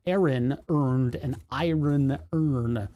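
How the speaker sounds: very slow, separated "Aaron earned an iron urn"; drawn-out, careful tongue-twister, every syllable sounded; over-enunciated. Shown as a demonstration.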